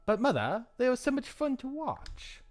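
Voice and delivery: put-on high-pitched child pleading with mother; young girl. Young Lucette protests to mother, high girlish plea.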